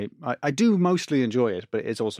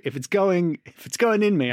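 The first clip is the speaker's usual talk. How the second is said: mock insistence; 6 semitones higher, 3 dB louder than usual. Acting out defiance to doctor.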